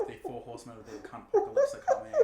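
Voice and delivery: high, fast, through laughter; laughing. Real, not acted. Joking with co-host about the drama while laughing high and fast. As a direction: cackle out the line at your own joke.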